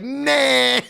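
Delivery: high, mimicked "nah"; mock a co-host's high-pitched dismissal; Monet. Teasing co-host about a habit, mimicked high voice. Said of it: "that's also another Monet thing".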